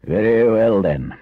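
loud, decisive Badger; firm. A narrator's reading. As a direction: close the argument firmly. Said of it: "said the Badger firmly".

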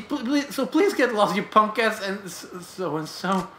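a scared punk babbling to get away; panicked apology. Acted. Punk caught by the old man, babbling high and panicked.

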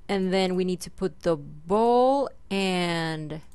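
ordinary talk

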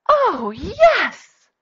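high, loud, thrilled reply; excited yes. Acted. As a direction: answer the invitation with hammed-up delight. Acted excited answer to a question.

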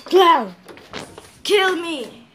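high, loud summons; calling out. Acted. Offering self to clown as sacrifice, shouted. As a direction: holler to the clown outside.

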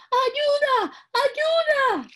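put-on high, loud panicked old woman cry; screaming for help. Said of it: "Cuando vio al lobo gritó".

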